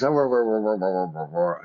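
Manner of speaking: imitated dog bark, lightly growled. Dog barking back at its owner, imitated barks. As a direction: bark like a happy dog bringing the stick. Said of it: "And the dog goes".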